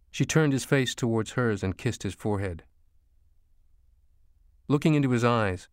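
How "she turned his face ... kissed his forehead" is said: usual speaking voice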